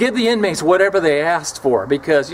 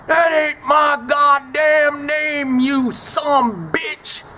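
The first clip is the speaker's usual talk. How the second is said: slow, higher furious shout; 12 semitones higher, much slower than usual. Retold or imitated. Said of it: "And he went crazy".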